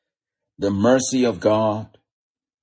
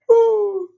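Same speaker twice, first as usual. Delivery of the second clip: very high, drawn "ooh"; ecstatic whoop; 4 dB louder than usual. Real, not acted. Preacher overcome as he retells healing, high whoop. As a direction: let out a high whoop of praise.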